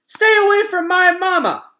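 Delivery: high, loud squawking cry; shrieking goose. Acted. Young goose shouting at robots to protect mother. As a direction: shriek like an angry gosling.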